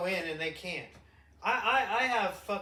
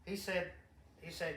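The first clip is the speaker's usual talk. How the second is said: ordinary talk, nothing marked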